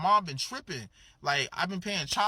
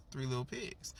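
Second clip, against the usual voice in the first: ordinary talk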